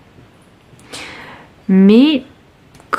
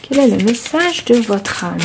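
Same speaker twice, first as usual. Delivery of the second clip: southern French accent. Shown as a demonstration. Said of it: "j'ai pris l'accent du sud un petit moment".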